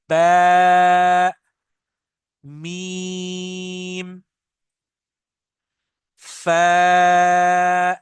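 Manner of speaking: बा, मीम, फा each drawn out with closed lips; lip letters demo. Shown as a demonstration. Teacher showing students the three lip letters. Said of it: "lips close करने पड़ेंगे".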